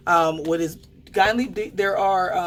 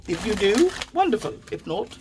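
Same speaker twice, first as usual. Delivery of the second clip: a much higher, put-on fake British accent; 6 semitones higher than usual. A tarot reader telling viewers either choice is fine, in a fake British accent. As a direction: play at being posh English, lightly, in a fake British accent. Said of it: "I don't know where the fake British accent".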